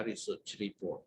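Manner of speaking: ordinary talk, nothing marked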